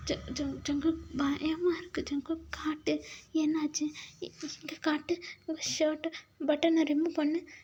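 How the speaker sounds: stammering, high and soft; acted hushed, trembly fear; frightened. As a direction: plead anxiously to be shown.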